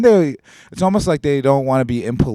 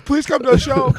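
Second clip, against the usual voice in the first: mock pleading; 4 dB louder than usual. Begging pitch, mock pleading.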